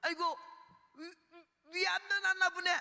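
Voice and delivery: high, loud exclamation, breaking off and restarting; astonished joyful shout. Retold or imitated. Patient keeps water down after stomach cancer, astonished high shout. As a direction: cry out high at a sudden healing.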